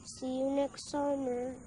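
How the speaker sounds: very soft, subdued farewell; hushed goodbye. Acted. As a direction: say it back quietly, almost to yourself. Subdued goodbye between children. (mahinang pamamaalam)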